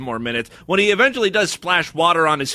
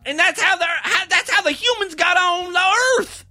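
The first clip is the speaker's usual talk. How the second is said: much higher put-on voice, gleefully ridiculing; mock creationist yokel; 11 semitones higher than usual. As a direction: ham up a dim believer's origin story. Parodying creationist teenager's belief.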